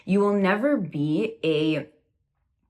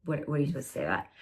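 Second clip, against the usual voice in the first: baffled aside; 3 semitones lower, 7 dB quieter, much faster than usual. Retold or imitated. Baffled aside after rude comment.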